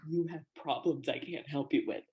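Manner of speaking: lightly imitated low, quiet authority; a hint of a hushed, solemn teacher; grave program head. Program head turning away her student, lightly imitated gravity.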